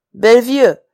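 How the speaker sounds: loud, cold royal command; king coldly summoning his guard captain; regal coldness. Acted. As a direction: call the captain with icy authority. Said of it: "comandă cu răceală regală".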